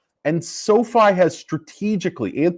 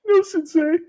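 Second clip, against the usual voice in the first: high student reply; 12 semitones higher than usual. Retold or imitated. Student answering sensei.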